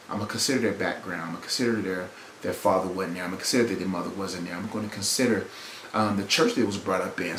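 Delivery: very low, soft thinking aloud; empathetic reflection. Acted. Preacher models considering someone's upbringing, quiet empathetic reflection. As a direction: reason it through quietly to yourself.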